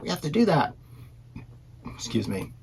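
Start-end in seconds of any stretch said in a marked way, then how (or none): 0.0-0.7 put-on excited kid. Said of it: "she lost her mind and was like".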